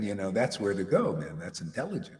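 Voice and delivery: usual speaking voice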